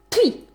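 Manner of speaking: sharp high spitting sound; a scornful "pah!"; contemptuous spit. Acted. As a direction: spit at him in disgust. Spitting at groveling ex.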